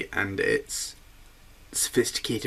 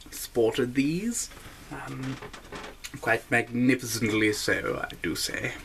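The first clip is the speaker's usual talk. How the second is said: higher, plummy RAF officer voice; posh British officer; 6 semitones higher than usual. Acted. Describing the handlebar mustache as a proud RAF officer. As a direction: play a pompous British airman, savouring each word.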